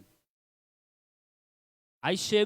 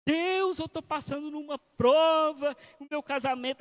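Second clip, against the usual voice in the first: higher and louder, slow complaining plea; whiny prayer; 6 semitones higher, 4 dB louder than usual. Acted. Abusive husband complaining to God about his marriage, whining loudly. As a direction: cry out to God like a victim.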